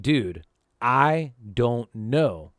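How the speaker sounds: drawn-out, slowed-down denial; slow helpless repeat. Retold or imitated. A scared teen repeats himself slowly to the angry stranger. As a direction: stretch each word out, worn down. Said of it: "And I'm like".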